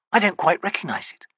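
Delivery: unease in a whisper. Acted. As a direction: breathe it out, uneasy. Uneasy wizard alone.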